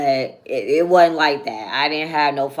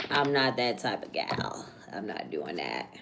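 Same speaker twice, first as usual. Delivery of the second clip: soft, low whispered denial; whispered aside; 6 semitones lower, 9 dB quieter than usual. Real, not acted. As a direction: mutter it under your breath. Denial muttered to herself.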